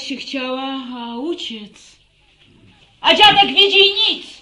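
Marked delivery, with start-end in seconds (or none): 3.0-4.4 high, loud reproach; angry outburst. Acted. Mother scolds grandfather for doing nothing, loud. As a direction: lash out at the old man.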